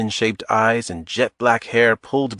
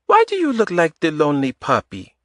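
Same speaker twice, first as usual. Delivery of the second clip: put-on higher woman's voice with a light Eastern European accent; 7 semitones higher than usual. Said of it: "In a light Eastern European accent".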